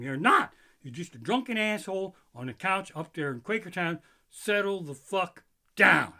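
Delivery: loud, scolding mock warning label. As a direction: bark a warning at drunk fans. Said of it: "the warning on the side of the case of beer should be".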